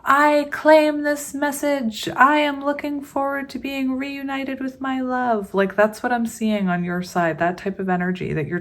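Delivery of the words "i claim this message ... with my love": channel an earnest commenter claiming the message; mock-earnest viewer voice, pitched up. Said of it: "one of those people in my comment section that's like".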